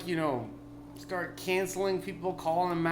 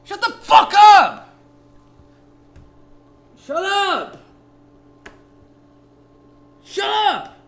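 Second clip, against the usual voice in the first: much higher, louder, slow shut up; furious shout; 15 dB louder, slower than usual. Acted. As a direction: scream it at them. Repeated yelled retort.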